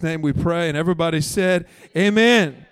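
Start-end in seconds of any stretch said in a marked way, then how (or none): 1.9-2.7 loud rising call of Amen; preacher's loud amen. Real, not acted. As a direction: lead the room in a big amen.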